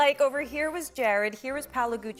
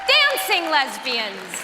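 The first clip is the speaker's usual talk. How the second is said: slow emphatic punchline; 7 dB louder, slower than usual. Acted. Hosting the Oscars.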